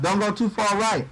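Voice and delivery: normal talking voice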